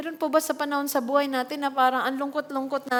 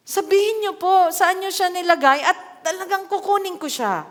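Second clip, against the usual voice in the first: higher, louder urgent plea; pleading Mary; 6 semitones higher, 4 dB louder than usual. Retold or imitated. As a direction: beg to be told where he is. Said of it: "love na love niya si Jesus". (mataas at malakas na pakiusap ni Mary)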